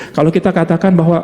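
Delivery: ordinary delivery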